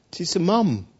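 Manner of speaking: ordinary talk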